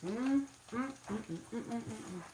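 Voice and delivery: delighted mm mm while tasting; happy mmm of good food; savoring hums. Real, not acted. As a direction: hum with pleasure over a mouthful. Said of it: "I have food in my mouth".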